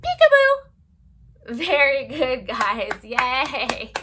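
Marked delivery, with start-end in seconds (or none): 0.0-1.6 high, loud and slow peekaboo; spring the surprise on the kids; drawn-out peekaboo reveal; playful peekaboo. Pretend. Peekaboo game with children.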